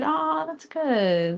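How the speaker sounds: high, long drawn-out aww; cooing approval. Real, not acted. Streamer hears a viewer is doing well, cooing. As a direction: coo sweetly, stretching it right out.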